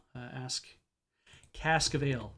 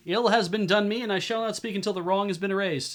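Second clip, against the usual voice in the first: higher, aggrieved woman's voice; female spirit voice; 5 semitones higher, 5 dB louder than usual. Acted. Spirit woman speaking into Locklear's mind, higher female voice. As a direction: intone it as a wronged spirit, pitched up. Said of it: "A female voice suddenly filled".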